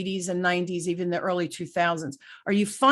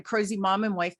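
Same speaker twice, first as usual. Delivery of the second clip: usual speaking voice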